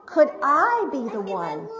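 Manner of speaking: ordinary delivery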